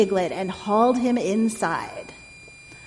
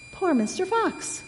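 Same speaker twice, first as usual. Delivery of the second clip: high, soft piglet sympathy; softly cooed, pitched way up; sighing piglet; 8 semitones higher, 5 dB quieter than usual. A narrator's reading. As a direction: sigh with pity in a little pig voice. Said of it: "sighed the piglet".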